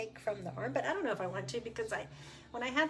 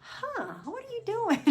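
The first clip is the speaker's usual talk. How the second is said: much higher, puzzled nurse voice; curious nurse; 14 semitones higher, 3 dB louder than usual. Retold or imitated. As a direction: voice the nurse's baffled question. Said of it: "she was just like".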